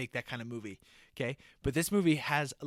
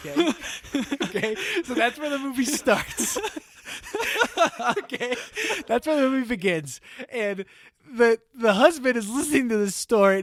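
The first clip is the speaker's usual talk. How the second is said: laughing; 8 semitones higher, 9 dB louder than usual. Real, not acted. Cracking up at own joke.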